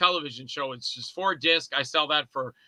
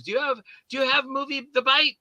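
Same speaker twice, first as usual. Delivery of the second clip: Lily's voice; 8 semitones higher than usual. Retold or imitated. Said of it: "and she goes".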